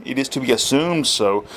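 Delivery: usual speaking voice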